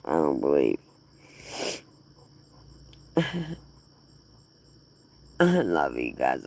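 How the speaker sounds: ordinary talk, nothing marked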